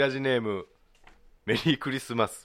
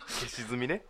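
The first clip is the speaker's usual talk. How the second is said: laughing through the words; stifled giggles; 13 semitones higher, 14 dB louder, much slower than usual. Real, not acted. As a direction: giggles breaking the reading. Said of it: "先に笑うなよ".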